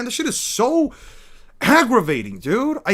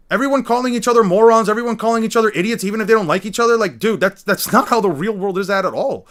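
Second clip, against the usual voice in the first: ordinary delivery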